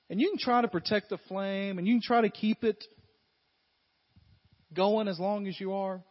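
high, loud, forceful preaching. Acted. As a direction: preach it out to the whole room. Preacher demonstrates with burning match.

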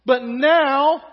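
loud, slow, drawn-out moaning about the food; melodramatic whining. Acted. Israelites grumbling to Moses about the manna, melodramatic and loud. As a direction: ham up the grumbling, slowly and loudly. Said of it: "A little melodramatic".

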